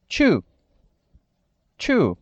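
too pronounced like an English "too", long oo; model English word. Shown as a demonstration. Teacher modeling the word for Brazilian students, careful English pronunciation. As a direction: say "too" slowly and clearly as a model. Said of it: "Próxima palavra".

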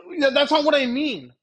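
high, loud flustered retort; taken aback. Retold or imitated. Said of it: "kind of taken aback and goes".

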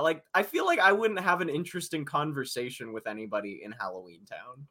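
ordinary talk

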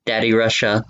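ordinary talk, nothing marked

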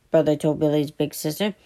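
plain reading voice

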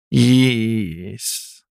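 long nasal drawn-out yes. A narrator's reading. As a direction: stretch the yes through the nose. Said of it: "long, drawn-out, nasally".

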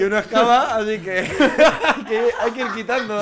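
bursting with laughter, high and loud; laughing. Real, not acted. Joking that one friend gets cut, laughing loudly.

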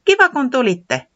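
high, loud happy greeting; calling out. Acted. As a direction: call to friends arriving. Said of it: "se huikkasi".

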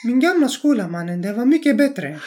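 high, mock-earnest classmate voice; light impression of a school friend; imitating the classmate. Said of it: "jag måste göra hans röst då".

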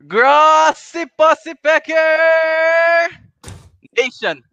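slow, high and loud, stretch every word like a stadium announcer; hype announcer intro. Acted. Host opens the podcast with a big stadium-style intro. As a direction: boom out the show name like a big-stage emcee. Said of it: "that was the greatest introdu".

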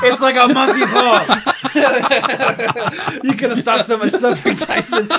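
high-pitched laughing speech. Real, not acted. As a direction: talk through a burst of laughter. Laughing through the line.

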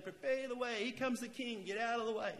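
loud, high, urgent call; herald's shout. Acted. Preacher mimics someone clearing the way for the king, loud shout. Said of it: "it's not like someone saying".

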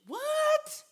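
very high, loud what; incredulous what. Real, not acted. Preacher reacting to a naive claim, high incredulous what. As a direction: squeal it in disbelief.

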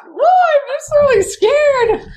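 laughing at her own silly fear, hammed-up; mock scared. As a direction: ham up the terror while laughing. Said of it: "So I really thought".

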